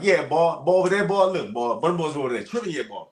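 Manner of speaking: high, loud fast Southern slang; Louisiana dialect. Retold or imitated. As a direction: rattle it off in Louisiana talk. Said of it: "I'm like".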